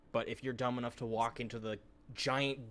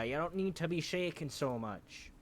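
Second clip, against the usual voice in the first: put-on Irish accent; 7 semitones higher, 7 dB louder, slower than usual. Irish-tinged retort to a shaky character.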